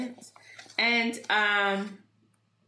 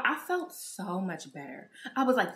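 usual speaking voice

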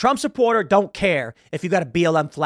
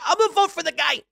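mock Rapaport; 13 semitones higher than usual. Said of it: "he's like".

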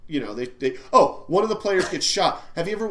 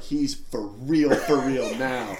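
low, slow, mock-solemn delivery; awed realization; 7 semitones lower, much slower than usual. Teammates realizing the coach is serious, hushed-awe impression. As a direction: let it sink in slowly and low. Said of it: "they all look at each other like".

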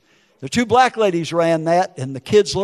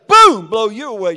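sudden loud, raised burst; explosive boom; 5 semitones higher, 8 dB louder than usual. Retold or imitated. Man imagining shooting McVeigh, sudden loud outburst. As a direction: punch out the gunshot word.

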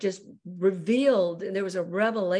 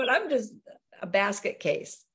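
ordinary talk, nothing marked

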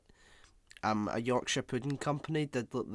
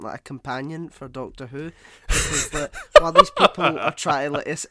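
usual speaking voice

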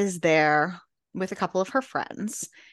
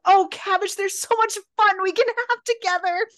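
high, loud mock delight; gleeful teasing; 12 semitones higher, 5 dB louder than usual. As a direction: gush with over-the-top excitement. [name] gleefully teasing his new ward.

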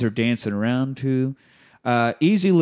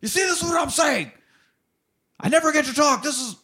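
high, fast exasperated mock yell; mock screaming; faster than usual. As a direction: squeal it like he's finally snapping. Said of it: "finally starts screaming at her".

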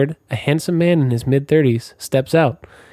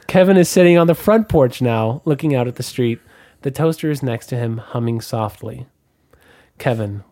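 ordinary talk

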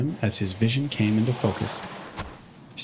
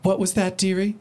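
Rarity voice; 9 semitones higher than usual. Acted.

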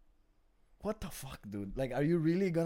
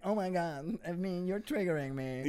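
mock offended whine; 5 semitones higher than usual. Mocking an offended audience member, higher whiny put-on voice. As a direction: parody a touchy heckler taking offence. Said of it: "chubby Russian bitch is like".

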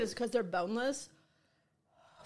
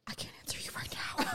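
a strained, unvoiced whisper; imitated whisper; 10 dB quieter than usual. Imitating a burned-out guest whispering to the host. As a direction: gasp it out as if you barely can speak. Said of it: "And they were like".

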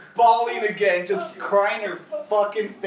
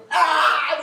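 high loud startled yelp; shocked yelp; 9 semitones higher, 6 dB louder than usual. Retold or imitated. Friends staring at each other, a startled yelp. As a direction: let out a sudden shocked cry.

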